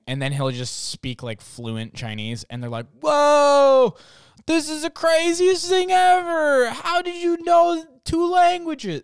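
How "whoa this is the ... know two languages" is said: very high, loud, stretched-out mock amazement; hammed-up gushing astonishment; amazed and delighted. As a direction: imitate an astonished onlooker, way over the top. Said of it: "they're so happy".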